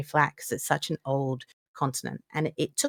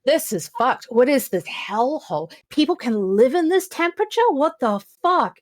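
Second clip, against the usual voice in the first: higher-pitched, incredulous outburst; appalled disbelief; 7 semitones higher, 5 dB louder than usual. Retold or imitated. Traveler wanting back on the plane, appalled. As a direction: react as if you've landed in a hellhole. Acted reaction to arriving in heat.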